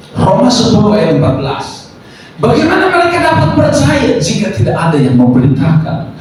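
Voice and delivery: high, ringing pulpit cry; full-force preaching. Real, not acted. Preacher proclaiming scripture and a charge to the church, full-force preaching. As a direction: proclaim the verse, each phrase launched high and falling.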